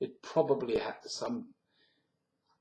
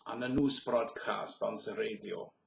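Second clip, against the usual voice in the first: normal talking voice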